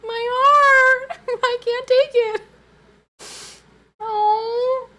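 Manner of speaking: high, loud squeal with a laugh; overwhelmed gush. Real, not acted. Streamer squealing to chat about gender ornaments, overwhelmed. Gushing over baby ornaments.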